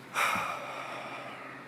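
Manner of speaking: long voiceless sigh; heavy sigh. Real, not acted. Pausing before the outpatient story, a heavy real sigh. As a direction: exhale before going on.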